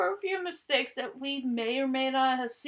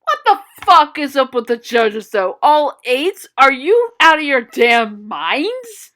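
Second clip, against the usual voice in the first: outraged shouting; 10 dB louder than usual. Real, not acted. Outraged rant at judges.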